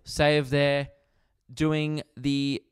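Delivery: ordinary talk, nothing marked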